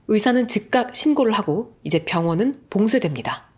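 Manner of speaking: usual speaking voice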